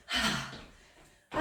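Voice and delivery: sharp breath out through the lips; blowing out hard. Real, not acted. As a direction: blow out hard on the effort. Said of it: "Tu m'entends souffler?".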